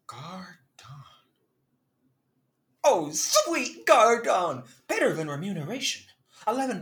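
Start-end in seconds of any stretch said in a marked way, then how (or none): 0.1-0.6 breathy whisper of the word. Acted. Tastes the new word. 2.8-4.6 hammy stage actor. Acted. Delighted with the tip.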